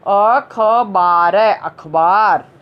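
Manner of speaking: अखबार drawn out slowly, syllable by syllable; slow modelled word. Shown as a demonstration. Teacher to children, slowly modelling अखबार. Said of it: "teacher models word for children".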